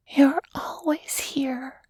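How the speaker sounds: put-on high, slow, airy little-girl whisper; whispering child. A narrator's reading. Small girl to a cloud in the night sky, whispering. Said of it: "Krista whispered".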